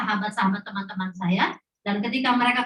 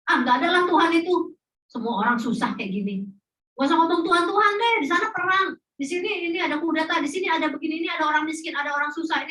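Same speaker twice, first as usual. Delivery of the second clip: angry unbeliever; 6 semitones higher than usual. Retold or imitated. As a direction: scoff at God, fed up. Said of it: "dia marah sama Tuhan". (tiruan orang yang marah pada Tuhan, nada tinggi)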